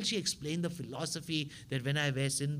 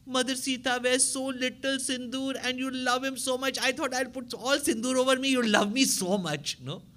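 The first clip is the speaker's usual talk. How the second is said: put-on innocent child; 10 semitones higher, 4 dB louder than usual. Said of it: "He says".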